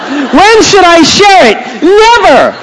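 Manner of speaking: high, loud, drawn-out comic shout; emphatic shouted punchline. Acted. Pastor answering his own question for laughs, emphatic shout.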